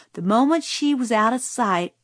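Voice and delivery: ordinary delivery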